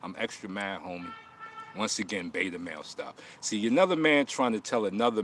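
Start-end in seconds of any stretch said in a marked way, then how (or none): 0.0-1.1 softer, deadpan pretend anger; mock mean face. Teasing a viewer with soft pretend anger. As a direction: say it quietly, teasing. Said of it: "Let me make my mean face".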